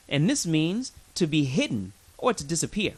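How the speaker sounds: ordinary talk, nothing marked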